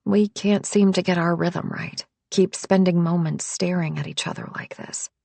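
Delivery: plain reading voice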